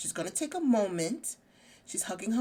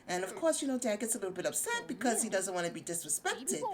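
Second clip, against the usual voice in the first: ordinary talk, nothing marked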